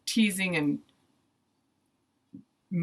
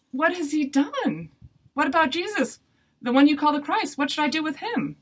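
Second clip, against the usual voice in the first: high-pitched, raised-voice questioning; shocked; 9 semitones higher, 5 dB louder than usual. A narrator's reading. Shocked Pilate asks the crowd about Jesus, incredulous. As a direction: plead in disbelief, voice pitched right up. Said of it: "Pilate was shocked".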